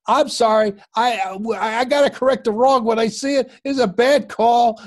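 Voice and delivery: high, loud mock yelling at the umpire; retold screaming. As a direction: yell it like a manager protesting a bad call, slowing on the last words. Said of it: "he's screaming at Doug Harvey".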